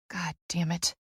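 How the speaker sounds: soft, under-the-breath curse; muttered curse. A narrator's reading. Therapist cursing to herself at his stubbornness, muttered. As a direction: curse quietly to yourself. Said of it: "I mutter".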